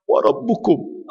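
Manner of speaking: ordinary talk, nothing marked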